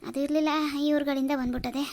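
normal talking voice

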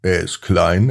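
plain reading voice

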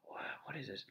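hushed whisper, almost no voice. Real, not acted. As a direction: whisper it like a secret. Tasting candy, hushed reaction.

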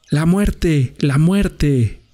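high-pitched dog's cry of alarm, falling; howled warning. A narrator's reading. Young dog warns the others on seeing Death, high howl. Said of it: "aulló".

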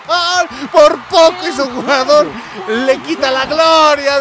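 high, loud cry breaking into laughter; excited goal call. Real, not acted. Commentator celebrating a narrowly scored goal, loud excited cry. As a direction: belt it out, stretching the words.

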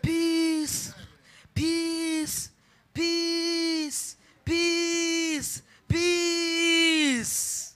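high, drawn-out calls of peace, rising to a shout; the Lord commanding peace. Retold or imitated. Preacher voicing the Lord telling her peace, a long call. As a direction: intone peace like a divine command. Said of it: "He said".

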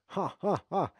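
loud, high "ha, ha, ha"; separate, punched laughs of triumph; crowing, deliberate laugh; gloating laugh. Real, not acted. Gloats at the opponent.

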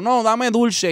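daughter's high whine for sweets; whiny little girl. Retold or imitated. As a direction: beg for candy like a small daughter. Daughter insisting on candy.

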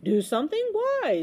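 breezy question leaping high; smiling. A narrator's reading. Unbothered captain answers the boy, smiling. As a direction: ask with a carefree smile. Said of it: "smiled the captain".

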